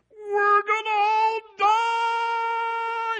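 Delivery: long, high, loud wail of doom; mock panic. Preacher mimicking Christians who think it's over, mock-panicked wail.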